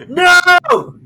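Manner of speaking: high, loud mock cry of horror; mock shout. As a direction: yell it like a horror movie victim. Reacting to the burial ground joke.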